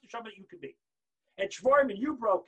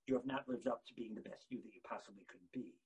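ordinary delivery

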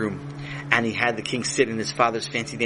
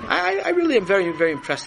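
gushing high; 10 semitones higher, 3 dB louder than usual. Acted. King gushes, voice jumps high.

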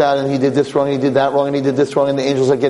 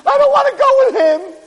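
high, loud tearful plea; hope through tears; mock crying; 4 dB louder than usual. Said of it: "He's crying".

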